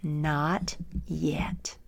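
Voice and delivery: soft whispered refusal. Acted. Potter shaking his head at the clay, whispered. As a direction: mouth it gently through the door. Master's repeated quiet refusal.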